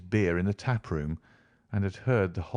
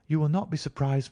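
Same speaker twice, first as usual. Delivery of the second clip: higher, lighter voice for the lady client; young woman's letter voice; 7 semitones higher than usual. A narrator's reading. Client writing to Holmes, higher anxious young woman. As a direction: read her words as an anxious young woman.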